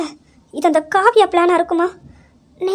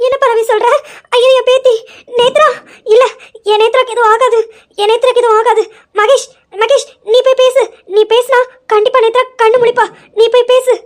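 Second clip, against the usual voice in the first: higher, anguished, sobbing pleas; distraught crying; 5 semitones higher than usual. Acted. Grandmother begging Mahesh to talk to Netra, crying in anguish. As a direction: beg through tears, voice climbing.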